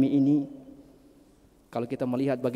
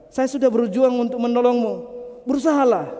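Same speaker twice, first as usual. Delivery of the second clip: loud call; 10 semitones higher, 8 dB louder than usual. Acted. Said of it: "Kemudian dia katakan".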